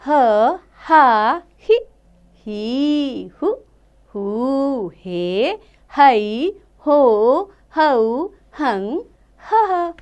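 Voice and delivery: slow, drawn-out teacher's chant of each syllable; slow syllable recitation. Shown as a demonstration. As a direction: sound out every syllable slowly for children. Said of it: "इसको आप आगे की बड़ी कक्षाओं में पढ़ेंगे". (धीमे-धीमे अक्षरों का उच्चारण)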